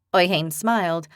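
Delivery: usual speaking voice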